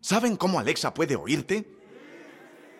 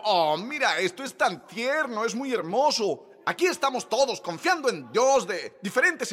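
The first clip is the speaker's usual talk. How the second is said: raised, impassioned preaching; 4 semitones higher than usual. Acted. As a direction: build the fervor toward the congregation. Sermón con fervor creciente. (predicación apasionada, más aguda)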